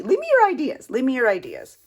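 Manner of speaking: very high, loud call, then easing down; loud, high-pitched enthusiastic urging; excited plea. Real, not acted. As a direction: call out eagerly to your viewers. Excited plea to viewers for ideas.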